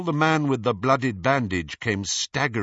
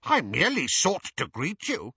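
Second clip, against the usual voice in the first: cool; 7 semitones higher than usual. A narrator's reading. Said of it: "said Travers coolly".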